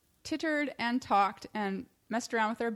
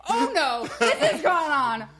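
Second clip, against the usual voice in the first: loud, high-pitched outrage; hammed-up righteous indignation; indignant outburst; 5 semitones higher, 9 dB louder than usual. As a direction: act out being totally fed up, big and loud. Acts out own outraged reaction.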